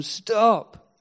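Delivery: high, loud cry of stop; shouted stop. Retold or imitated. Imagined angel crying out from heaven, a high loud shout. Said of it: "cry out of heaven".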